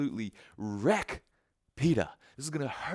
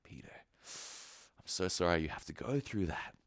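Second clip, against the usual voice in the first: softer, gentle apology; tender, sorry; 8 semitones lower, 10 dB quieter than usual. Retold or imitated. Jesus gently telling Peter he is sorry. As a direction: say it softly like a sorry friend. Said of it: "looking at Peter like".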